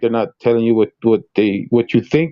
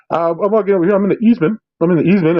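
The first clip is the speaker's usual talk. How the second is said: higher-pitched mock auditor patter; rehearsed auditor; 7 semitones higher, faster than usual. Host mocks auditors spewing a rehearsed script, lightly imitated. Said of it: "'cause nobody talks like that".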